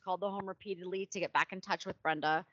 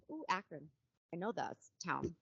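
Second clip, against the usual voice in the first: soft knowing aside; quiet aside; 2 semitones lower, 8 dB quieter than usual. Real, not acted. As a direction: drop it in under the breath. Aside about a known town.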